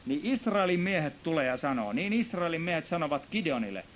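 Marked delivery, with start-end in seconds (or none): none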